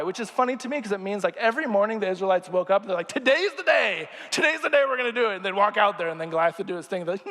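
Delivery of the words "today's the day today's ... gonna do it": higher, eager rallying voice; mock pep talk. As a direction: pump yourself up, half-joking. Israelites psyching up daily.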